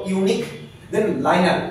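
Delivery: "ইউনিক", a pause, then "লাইনার"; split pronunciation. Shown as a demonstration. Teacher models the term for students, split in two. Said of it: "এইভাবে উচ্চারণ করবো".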